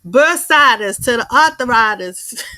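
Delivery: laughing while quoting her southern twang; loud, amused echo of the old lady; laughing imitation. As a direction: repeat her line through a laugh. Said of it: "she got that southern twang".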